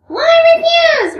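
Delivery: usual speaking voice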